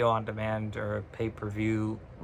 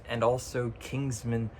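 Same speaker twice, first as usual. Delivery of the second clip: ordinary talk, nothing marked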